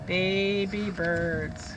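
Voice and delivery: very slow, careful title; teacher reading a book title. Shown as a demonstration. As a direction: read the title word by word. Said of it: "called Baby Birds".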